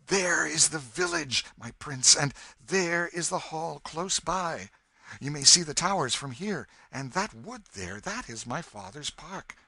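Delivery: loud, elated storybook hero pointing out home; excited cry. A narrator's reading. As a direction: cry it out with eager pride. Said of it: "cried out excitedly".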